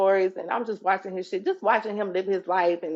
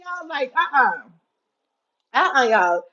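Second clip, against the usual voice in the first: normal talking voice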